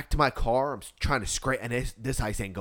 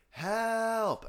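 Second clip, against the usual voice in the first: retold cry for help; 10 semitones higher, 5 dB quieter than usual. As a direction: imitate a far-off cry for help. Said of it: "You just screamed for help".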